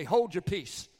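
forceful rebuke in Jesus' voice; commanding rebuke. Retold or imitated. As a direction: order the spirit out with authority. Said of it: "Jesus would say".

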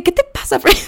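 high disbelieving scold; exasperated mock. Reacting to Jimmy's taco story.

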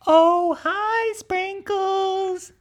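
high, drawn-out uneasy hello; wary greeting. Retold or imitated. Schoolmates avoiding the rat-eating unicorn, wary greeting. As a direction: stretch it out high and careful. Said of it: "they're all like".

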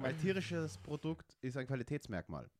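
ordinary talk

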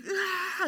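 high mock toddler wail; imitated belligerent cry. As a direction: wail like an angry toddler in a retelling. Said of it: "he starts crying, this belligerent cry".

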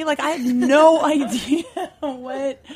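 slow words broken by a real laugh. Real, not acted. Telling the fan story to the host, laughing through it.

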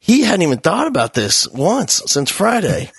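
ordinary talk, nothing marked

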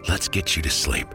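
plain reading voice

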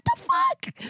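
high, slow, stretched what the fuck; exasperated disbelief. Real, not acted. Exasperated about the dad's naming.

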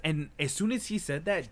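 ordinary talk

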